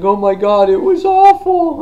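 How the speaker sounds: very high, loud hammed-up cry; distraught mock wail. Lori runs to her husband, high mock wail.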